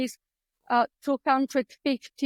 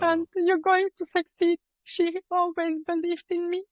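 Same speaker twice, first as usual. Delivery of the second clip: real emotion, tearful; 5 semitones higher than usual. Real, not acted. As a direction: voice lifted high with welling emotion. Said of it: "I'm going to get emotional".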